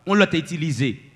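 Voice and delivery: ordinary talk, nothing marked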